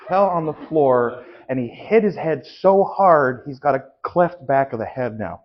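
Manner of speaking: normal talking voice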